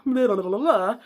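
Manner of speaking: loud, tumbling "blah blah blah"; silly babbling. Real, not acted. Vlogger an hour into this vlog, babbling. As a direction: babble it out loudly and playfully.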